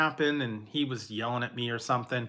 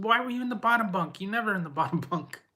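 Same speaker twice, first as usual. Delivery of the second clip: puzzled mom; 7 semitones higher than usual. Retold or imitated. Mother questioning the child.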